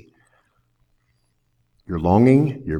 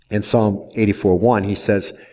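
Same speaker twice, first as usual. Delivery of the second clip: normal talking voice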